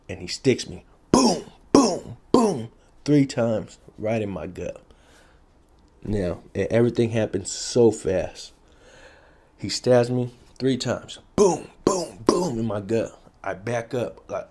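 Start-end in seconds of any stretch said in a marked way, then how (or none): 1.1-2.7 punchy high booms for each stab hit; stab sound effect. Retold or imitated. Retelling his stabbing to viewers, punchy booms. 11.4-12.2 stab sound effect. Retold or imitated. Imitating the stab blows again.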